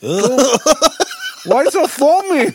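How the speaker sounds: slow, high, laughing through it. Real, not acted. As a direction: stretch it out while cracking up. Laughing at foaming mouth.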